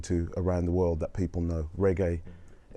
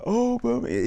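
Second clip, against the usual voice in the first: put-on host's Brummie accent, pitched much higher; mock Brummie accent. Australian host imitating a Brummie, mock Brummie accent. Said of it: "Even he knew he had to do the accent".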